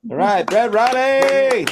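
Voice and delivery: high, loud, drawn-out name; announcer cheer. Real, not acted. Host applauding the comic after his set, rousing announcer shout. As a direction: call the act's name out long and high.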